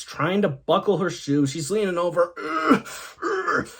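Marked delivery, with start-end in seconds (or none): 2.4-3.7 high, effortful grunts of struggle; mock straining. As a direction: strain like someone bent over a stuck shoe. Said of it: "She's struggling".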